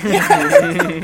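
high yeah breaking into a laugh; can barely get the yes out for giddiness; giddy laughing yeah. Retold or imitated. Fan answering the band singer, high and laughing. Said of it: "I'm like".

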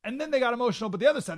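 ordinary delivery

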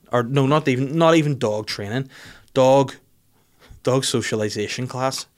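usual speaking voice

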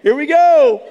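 high, loud cheer of mock bravado; excited bravado. To the young rep in front before the emergency landing, loud daring cheer. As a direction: throw it out like a rollercoaster yell.

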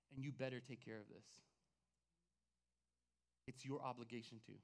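low, hushed, measured insistence; firm warning. Retold or imitated. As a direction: drop low and soft to lay down an obligation. Retold firm warning to company owner.

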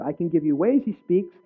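ordinary talk

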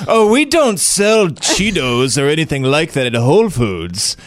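slow, put-on uppity SoCal accent. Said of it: "what is that accent?".

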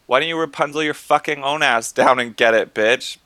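pretend yell up. Yelling up at the balcony woman, mock shout. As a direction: shout it up at her, cheeky. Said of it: "would have yelled up to her".

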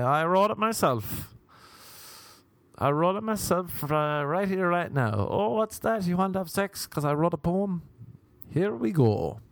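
high, hammed-up mock suitor; poem-quoting seducer. Trying to impress a lady, high mock boasting. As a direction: play a boastful would-be charmer, pitched well up. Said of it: "you whip that out and you say".

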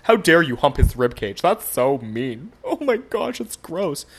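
hammed-up offended dog owner; mock pissed-off dog owner. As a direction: play at being outraged, pitch climbing toward the end. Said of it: "is getting real pissed off about it".

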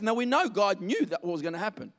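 ordinary delivery

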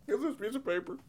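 high, soft laughing squeak; cracking up while telling it; giggling so hard the voice goes up; helpless laughter. Real, not acted. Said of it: "[name], keep it together".

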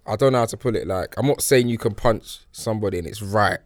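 usual speaking voice

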